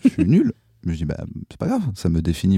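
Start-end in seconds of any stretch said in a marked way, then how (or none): 0.0-0.5 high, comic admission of defeat; mock defeat. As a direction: throw up your hands, losing. (défaite comique)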